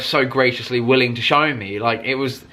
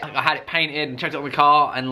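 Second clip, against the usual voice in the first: usual speaking voice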